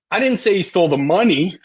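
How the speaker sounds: money punched high at the end; emphatic money, implying he took something else; stress on MONEY. Shown as a demonstration. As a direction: save the big emphasis for the word money. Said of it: "if you emphasize certain words".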